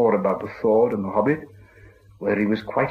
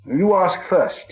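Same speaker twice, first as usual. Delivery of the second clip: stalling hobbit; 9 semitones higher, 4 dB louder, much slower than usual. A narrator's reading. Bilbo stalls for time.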